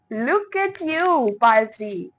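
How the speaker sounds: drawn-out gushing praise; admiring gush. Real, not acted. Reader admiring pile three's beauty, delighted gush.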